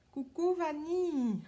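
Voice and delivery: high, loud, drawn-out hello; called-out greeting. Real, not acted. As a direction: sing out a long hello to a friend. Calling hello to arriving live viewer.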